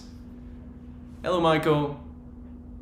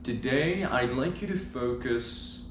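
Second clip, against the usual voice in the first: guided meditation; 5 dB quieter than usual. Real, not acted. Yoga teacher guiding viewers with eyes closed, guided meditation. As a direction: ease listeners into stillness, unhurried.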